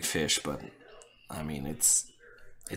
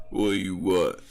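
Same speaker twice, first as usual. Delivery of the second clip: gruff broken English; 2 semitones lower than usual. Acted. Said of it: "Here's what I'm gonna do".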